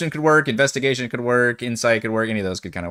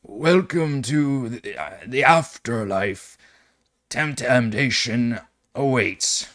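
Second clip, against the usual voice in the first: slow, deep, raspy ferryman intoning; slower than usual. Acted. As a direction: intone it slowly, deep and rasping. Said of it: "intones in a deep, raspy voice".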